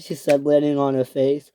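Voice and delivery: ordinary delivery, nothing marked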